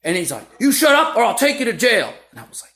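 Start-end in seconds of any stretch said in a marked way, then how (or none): 0.6-2.1 loud, high, forceful threat; retold authoritative shout; shouted warning. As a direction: imitate a cop yelling a final warning. Cop threatening the yelling crowd.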